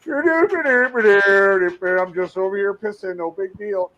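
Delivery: hammed-up nonchalant guy caught peeing; imitating the creepy coworker. Mocking a coworker caught peeing, high casual voice. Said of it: "He's just, like, looking at her like".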